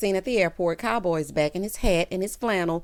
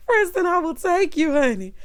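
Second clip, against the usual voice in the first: much higher, giddy and louder; laughing offer; 9 semitones higher, 4 dB louder than usual. Real, not acted. Viewer offering herself to Preston, giddy. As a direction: squeal it through leftover laughter.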